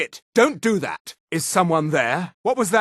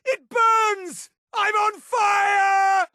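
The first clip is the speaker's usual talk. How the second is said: very high, drawn-out cry of agony; agonized scream; 12 semitones higher, much slower than usual. Acted. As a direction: scream it as you burn. Character is burning.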